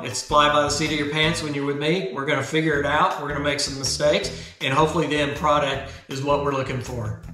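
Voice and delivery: normal talking voice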